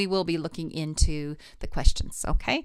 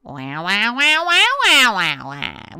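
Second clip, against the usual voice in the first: bright louder wah, like a duck; quacking wah; 3 semitones higher, 7 dB louder, slower than usual. Shown as a demonstration. Voice teacher leading the quacking wah warm-up. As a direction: quack it out, loud and twangy. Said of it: "Do it with me".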